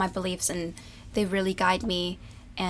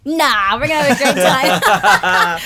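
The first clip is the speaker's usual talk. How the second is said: loud, higher, drawn-out NAH breaking into laughter; playful dismissal; 5 semitones higher, 11 dB louder, slower than usual. Real, not acted. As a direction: brush it off loudly, laughing. Jokingly brushing off the teasing question.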